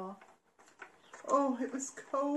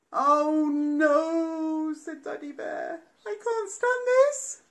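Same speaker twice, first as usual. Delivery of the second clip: higher louder despair; exasperated daddy bear; 4 semitones higher, 8 dB louder, slower than usual. Acted. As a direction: cry out fed up.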